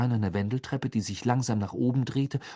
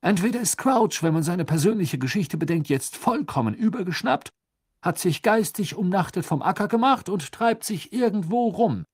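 higher, louder fussy official; high-pitched pompous minister; dismissive minister; 10 semitones higher, 5 dB louder than usual. A narrator's reading. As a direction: argue it away in a raised, insistent voice.

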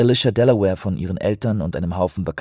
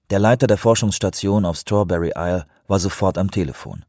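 ordinary delivery, nothing marked

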